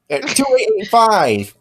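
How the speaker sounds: droid voice. Acted. Droid voice recognizing another droid.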